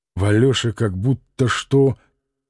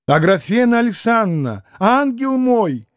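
calling out; 8 semitones higher than usual. A narrator's reading. Катерина Ивановна зовёт Грушеньку из другой комнаты, протяжно и выше. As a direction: call her in from afar. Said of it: "крикнула она вдруг кому-то".

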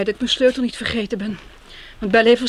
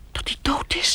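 whisper; 4 semitones higher, 3 dB quieter than usual. Acted. Afraid to ask aloud.

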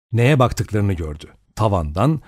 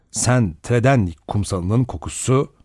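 plain reading voice

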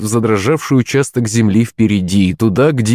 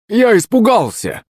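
high plaintive whine; lamenting; 10 semitones higher than usual. A narrator's reading. Servant excuses himself to his master, lamenting. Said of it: "запричитал Захарыч".

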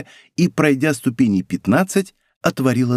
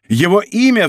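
high, urgent demand; heated exclamation, pitch raised; beside himself; 7 semitones higher, 4 dB louder than usual. A narrator's reading. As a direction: cry out, demanding an answer at once. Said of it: "вскричал вне себя Д'Артаньян".

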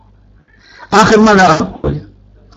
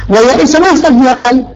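usual speaking voice

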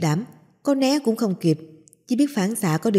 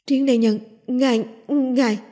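horrified stammer; 5 semitones higher than usual. Acted. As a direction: stammer in horror. Horrified stammering at poisoned hero.

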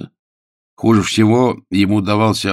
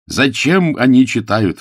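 loud, higher exclamation; exclaiming; 6 semitones higher, 7 dB louder than usual. A narrator's reading. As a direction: cry out the question in protest. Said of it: "воскликнул Пилчер".

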